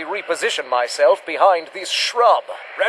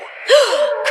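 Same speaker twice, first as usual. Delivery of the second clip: high, startled gasp; sharp gasp; 13 semitones higher than usual. Acted. Hunter gasping as the beast targets Guinevere. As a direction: gasp in alarm. Beast turns on his nurse.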